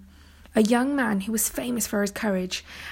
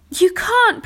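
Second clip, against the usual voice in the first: high, loud breathless protest; panting; 11 semitones higher, 9 dB louder than usual. A narrator's reading. Arthur bursting in to protest, panting. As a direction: burst out panting, pitched right up. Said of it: "panted Arthur".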